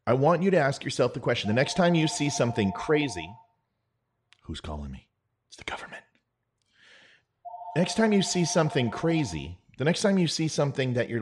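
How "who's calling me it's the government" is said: hushed, conspiratorial mock whisper; paranoid whisper. Paranoid conspiracy-theorist aside.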